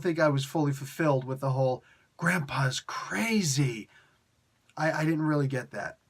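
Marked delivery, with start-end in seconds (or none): 2.2-3.9 slow, breathy whispered quote; hushed whisper. Retold or imitated. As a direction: whisper it like a dark secret. Mocking quote of Jonathan's line.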